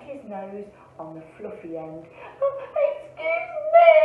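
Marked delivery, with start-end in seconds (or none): 2.4-3.9 high, loud indignant rabbit; squealed, full-voice protest; shouted. A narrator's reading. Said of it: "shouted the rabbit".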